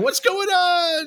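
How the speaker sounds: very high, slow, drawn-out confusion; baffled listener. Retold or imitated. Mocking the confused podcast listener, very high and slow. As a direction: stretch it out like a lost listener. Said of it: "as the listener, I'm going".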